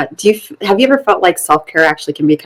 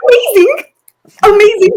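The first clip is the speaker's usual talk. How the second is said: excited; 13 semitones higher, 5 dB louder than usual. Real, not acted. Excited praise of son's news.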